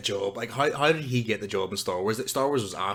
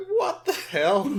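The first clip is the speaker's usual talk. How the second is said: high, slow, laughing mock bafflement; mock baffled; much slower than usual. Friend laughing while mimicking the stunned hero, high and slow.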